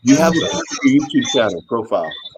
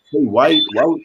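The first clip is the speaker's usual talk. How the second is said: ordinary delivery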